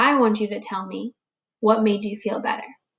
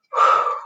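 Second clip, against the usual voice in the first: a long, breathy sigh of relief; big sigh; 3 dB louder than usual. Shown as a demonstration. Friends finding Bear, demonstrating a big sigh of relief. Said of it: "they go big sighs".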